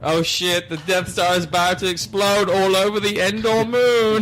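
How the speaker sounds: high, loud, mock-urgent outburst; hammed-up climax. Boyfriend warning the Death Star will explode, mock frantic. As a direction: build it frantically to the end.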